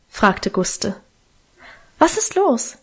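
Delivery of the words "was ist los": higher, lighter voice for the younger sister; higher girl voice. A narrator's reading. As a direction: lift it into a young girl's voice. Minchen asks.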